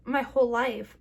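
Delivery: ordinary talk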